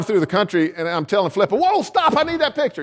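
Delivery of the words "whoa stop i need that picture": retold cry to stop, much higher; excited call. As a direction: shout it as if spotting the shot from the car. Calling sister to stop car.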